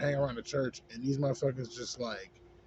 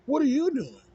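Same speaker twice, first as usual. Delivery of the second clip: much higher, louder mocking guard voice; mock the nosy adult, pitched way up; mocking imitation; 12 semitones higher, 7 dB louder than usual. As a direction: parrot the guard in a whiny put-on voice. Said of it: "almost like".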